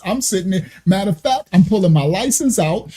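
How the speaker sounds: usual speaking voice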